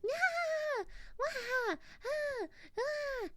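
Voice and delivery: high, fake whimpering wail; mock wailing. Maria left alone, high fake whimpering wail. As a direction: whine like a crying child.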